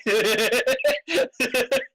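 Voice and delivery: high, rising laugh; amused laugh. Real, not acted. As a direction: burst out laughing, high-pitched. Player laughs at own remark.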